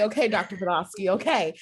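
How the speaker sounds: giggly resigned okay; laughing okay. Retold or imitated. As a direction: laugh through it at the doctor. Laughing reply to doctor.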